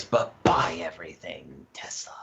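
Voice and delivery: whispered sly aside; conspiratorial whisper. Pretend. Co-host sneaking in stock advice after the disclaimer, whispered. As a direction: slip the tip in under your breath.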